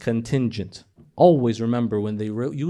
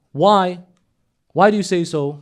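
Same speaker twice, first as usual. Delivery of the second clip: loud challenge; 4 semitones higher, 8 dB louder than usual. Acted. Challenging the critic loudly.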